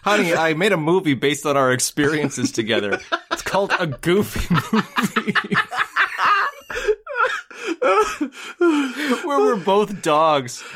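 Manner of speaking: proud dad breaking into giggles; laughing mock father. Katzenberg telling his daughter about the movie, laughing.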